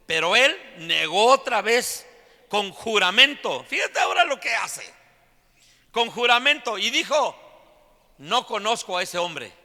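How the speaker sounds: high, loud preaching at full force. Real, not acted. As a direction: belt it out to the whole congregation. (predicación a todo pulmón, con voz alta)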